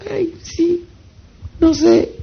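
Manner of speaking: high mock-timid squeak; timid interviewee. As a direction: mimic a shy interviewee squeaking out an answer. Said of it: "Con toda la timidez del mundo".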